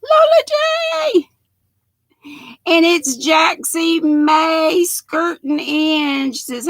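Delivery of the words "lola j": high, loud squeal of the name; playful high holler; excited greeting call. Acted. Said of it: "And I say".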